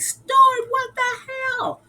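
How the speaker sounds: very high mock-appalled cry; retold exasperated outcry. Crew turning on Stuart as alarm sounds, mock outcry. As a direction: cry out at the name, then drag out the rest.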